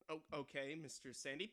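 ordinary talk, nothing marked

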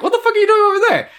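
very high, rapid-fire mock outburst; exasperated disbelief. On the phone to a friend parked on the wrong side, exasperated. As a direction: fire it off fast and high, half laughing.

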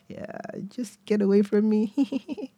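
high, slow, politely awkward chuckling; awkward fake laugh. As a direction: say it through a forced, awkward laugh. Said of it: "You know that awkward laugh".

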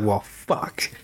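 low, quiet resignation; imitated letdown, dropped low and soft; upset, deflated. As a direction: mutter it in acted defeat. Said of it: "He's upset".

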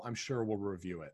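ordinary talk

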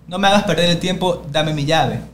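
loud, impatient order; rude demanding tone. Retold or imitated. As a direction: imitate a bossy neighbor cutting someone off. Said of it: "si usted viene en ese tono".

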